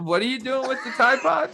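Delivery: high, accusing mock-parent voice; mock interrogation. To his co-host, teasing in a high mom voice. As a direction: grill him like a suspicious mom.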